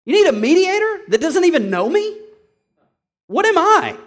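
high, incredulous challenge; indignant questioning. Acted. Preacher challenges those taking cases outside, indignant outburst. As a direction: raise the pitch to an affronted demand.

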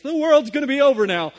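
mock doom. Mocking husband's overreaction.

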